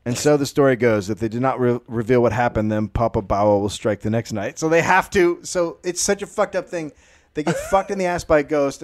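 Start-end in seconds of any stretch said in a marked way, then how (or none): none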